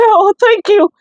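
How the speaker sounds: retold relief. Hearing good news from doctor.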